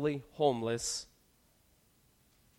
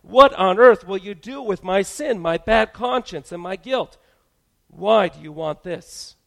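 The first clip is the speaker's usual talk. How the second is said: higher and much louder, caught off guard; quick startled reply; 5 semitones higher, 13 dB louder than usual. Acted. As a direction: blurt it out, taken aback. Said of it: "we quickly respond".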